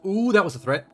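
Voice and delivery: high, loud delighted ooh; gleeful shock at a veiled threat; thrilled gasp. Real, not acted. Reacting to NPC threat.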